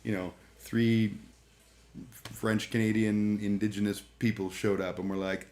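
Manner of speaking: ordinary delivery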